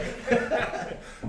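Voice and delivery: giggling burst; high laughter. Real, not acted. Storyteller laughing at his own punchline.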